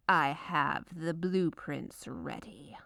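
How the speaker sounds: usual speaking voice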